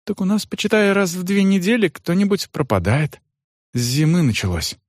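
servant Johan. Acted.

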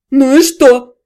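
jilted groom squealing defensively; nasty squeal. A narrator's reading. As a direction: spit it out in a nasty voice. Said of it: "противным голосом взвизнул тот".

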